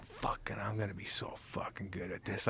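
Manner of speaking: whispered, intense promise to himself; whispered vow. Retold or imitated. Young comic alone at night, whispering to himself. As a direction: whisper it like a secret oath. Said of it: "would go home at night and go".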